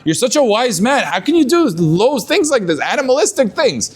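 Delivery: lightly voiced scolding of a hypocrite; incredulous challenge. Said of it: "And they would ask him".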